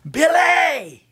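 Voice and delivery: name called high, loud and drawn out; mother's yell. Retold or imitated. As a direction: imitate mama yelling down the phone. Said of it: "She yelled at me again".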